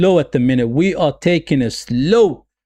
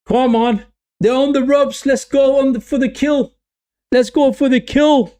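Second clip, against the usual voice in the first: excited; 7 semitones higher than usual. Real, not acted. Live match, Sweden pressing.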